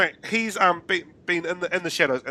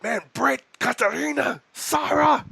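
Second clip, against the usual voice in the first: names called out, climbing higher with each one; losing his cool; 12 semitones higher, 4 dB louder, slower than usual. Retold or imitated. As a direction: build from upset to a high outburst. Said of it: "he loses his cool".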